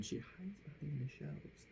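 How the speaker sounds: soft unvoiced whisper. A narrator's reading. Reader hushes down as the librarian hides. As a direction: breathe it like a secret.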